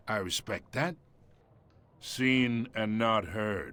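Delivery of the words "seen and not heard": slow put-down. Acted. Mob boss belittling Harvey.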